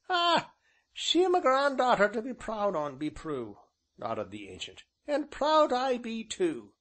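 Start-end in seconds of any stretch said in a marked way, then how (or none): 0.1-3.6 high, loud rustic ancient, falling at the end; high-voiced village elder, full of pride; proud old grandfather. A narrator's reading. 5.1-6.7 high, loud rustic elder; high-pitched country grandfather, boasting; proud old man. A narrator's reading. Voices the ancient.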